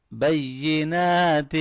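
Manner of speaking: بینات with the final aa stretched very slowly; بینات drawn out long; long vowel. Shown as a demonstration. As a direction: hold the aa of بینات. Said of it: "کھڑی زبر کو لمبا کریں گے".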